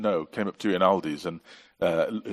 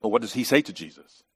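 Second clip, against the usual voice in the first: usual speaking voice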